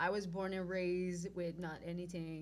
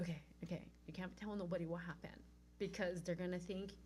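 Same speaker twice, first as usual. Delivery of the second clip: hushed self-talk; 7 dB quieter than usual. Retold or imitated. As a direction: coach yourself under your breath. Warning herself before seeing sister.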